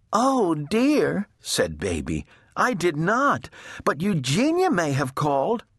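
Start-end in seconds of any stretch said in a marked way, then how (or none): 0.1-1.3 high, worried little-sister voice; fretting little sister. A narrator's reading. Baby is questioned by the firefighter, high and worried. 2.5-5.6 high little-sister voice; timid little sister. A narrator's reading. Baby explains to the firefighter, high and timid.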